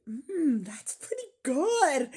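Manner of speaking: high, savoring mock approval; mock satisfied eater. Mimicking Axiom people slurping cup pizza, high and pleased. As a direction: imitate a happy slurper. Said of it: "they say".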